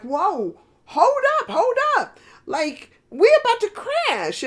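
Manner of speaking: high, loud panicked cry; panicked wave. Acted. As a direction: yelp it out like a wave about to crash. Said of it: "it started thinking like".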